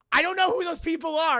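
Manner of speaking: high, loud baffled protest; mock bewildered. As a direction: protest in hammed-up confusion. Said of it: "Captain America's just like".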